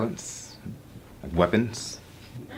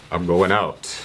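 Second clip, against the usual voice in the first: cool reply; 4 semitones lower, slower than usual. Acted. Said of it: "I reply coolly".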